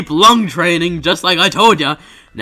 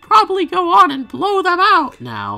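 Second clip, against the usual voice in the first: high cartoon helper voice; high character voice; 11 semitones higher than usual. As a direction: coax sweetly in a squeaky voice.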